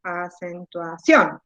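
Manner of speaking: measured syllables in "acentuación", stress on the last; exaggerated final stress. Shown as a demonstration. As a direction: draw out "acentuación" softly until CIÓN stands out. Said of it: "yo haría así".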